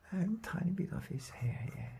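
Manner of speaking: a whisper to himself while adding detail; whispered aside. Real, not acted. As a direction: let the voice fall away to a whisper. Painter murmuring while working.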